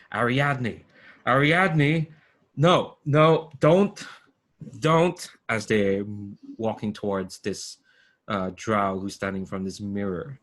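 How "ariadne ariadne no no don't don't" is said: higher, louder, urgent cries to stop her; shouting. Acted. Game master voicing a shouted warning to Ariadne, shouting. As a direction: call out in rising alarm. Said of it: "they're shouting".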